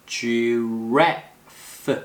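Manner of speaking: "Giraffe" drawn out, stressed RAF, separate f; second-syllable stress, clear F. Shown as a demonstration. Teacher warns about the F, second-syllable stress. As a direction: stretch "Giraffe" and release the final f distinctly. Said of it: "액센트 이음절".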